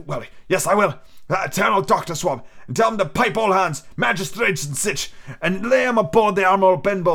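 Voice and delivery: put-on a sick old sailor coaxing the boy; old pirate captain. Captain confessing.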